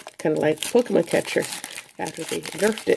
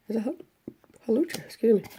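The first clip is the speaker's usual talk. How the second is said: talking through hiccups; hiccup-broken words; 6 semitones higher, faster than usual. Real, not acted. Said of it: "Have the hiccups".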